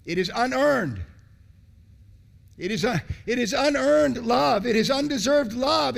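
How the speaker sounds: fiery preaching; preaching at full force. Acted. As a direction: preach it out, big and high.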